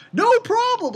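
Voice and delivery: high, loud salesman patter; used car salesman. Acted. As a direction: pitch it high like a pushy car dealer. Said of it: "Like a used sales car salesman".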